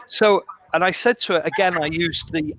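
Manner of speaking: normal talking voice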